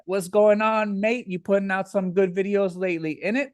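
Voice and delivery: put-on British accent. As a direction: greet a mate like a London bloke. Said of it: "that was a good impression".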